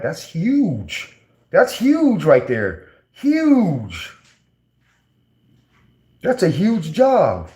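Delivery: impressed, slow and high on huge; amazed emphasis. Real, not acted. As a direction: lean into how huge it is, slowly. Marvelling at friend's UCLA contract.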